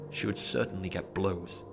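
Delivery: plain reading voice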